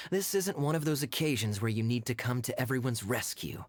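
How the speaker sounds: ordinary talk, nothing marked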